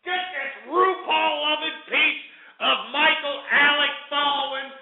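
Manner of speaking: high, furious yelling to the producer; angry shout. Real, not acted. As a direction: bark to get the caller cut off. Host yells to cut the caller.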